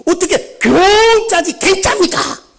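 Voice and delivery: 높고 느리게 "경자지"를 또박또박 바로잡는 항의조; 장로가 따지듯 "경"을 과장해 발음; mocking correction. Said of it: "목사님 너무 경상도 사투리를 많이 씁니다". (수석 장로가 목사 사투리를 따지는 높고 큰 목소리)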